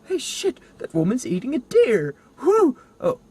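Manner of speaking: put-on high, loud, excited kid voices, slowing down; kids on camera. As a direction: ham up the kids filming, ending doomed. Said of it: "it cuts to their camera of, like".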